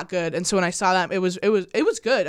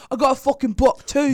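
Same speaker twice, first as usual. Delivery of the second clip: mock British accent with odd mouth sound; a hammed-up bad British bloke; 3 dB louder than usual. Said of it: "bad British accent moment".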